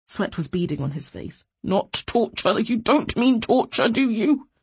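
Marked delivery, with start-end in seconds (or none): 1.6-4.5 higher, drawn-out frightened plea; panicked. A narrator's reading. Sweating prisoner pleading about torture, panicked. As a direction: beg to be contradicted. Panicking man begs reassurance.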